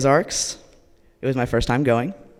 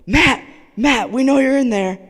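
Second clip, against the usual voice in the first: high, loud taunting holler; shouting; 12 semitones higher, 8 dB louder than usual. Retold or imitated. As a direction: yell at a friend hiding behind a door. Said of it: "They kept shouting and banging on the door".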